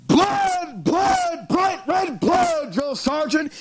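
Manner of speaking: high, drawn-out imitated soldiers' shout; top-of-lungs battle cry. Young soldiers answering their drill sergeant, imitated battle cry. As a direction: chant it out like fired-up recruits. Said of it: "at the top of our lungs would cry out".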